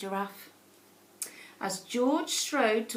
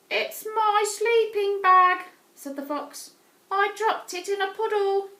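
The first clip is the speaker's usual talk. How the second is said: high, loud, wailing fox voice; crying fox; 8 semitones higher, 5 dB louder than usual. Acted. Fox crying to George about its wet sleeping bag, high and loud. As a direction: wail it like a weepy little fox. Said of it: "a fox who was crying".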